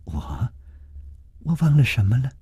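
soft hesitant start, rising into a question; a touch of dazed puzzlement; falter, then ask blankly; mild bewilderment. A narrator's reading. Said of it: "南平愣愣地说".

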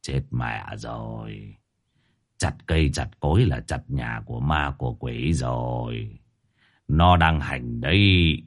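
low, slow, ominous master's voice; grave verdict. A narrator's reading. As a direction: pronounce the doom slowly and darkly. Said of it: "Tam Vô nhìn lắc đầu". (giọng thầy cúng trầm, chậm, đầy điềm gở)

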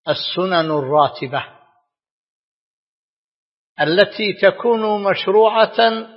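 normal talking voice

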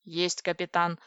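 usual speaking voice

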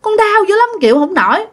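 high-pitched mock wail of pain; acted-out tearful plea, pitched well up; imitated tearful complaint. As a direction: whine it like a hurting girl pleading with her father. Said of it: "Mà khóc quá trời khóc".

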